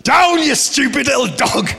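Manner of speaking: high, loud comic shout of command; exasperated high-pitched dog rebuke; scolding a dog. Retold or imitated. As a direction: yell it at an attacking little dog. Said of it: "I'm like".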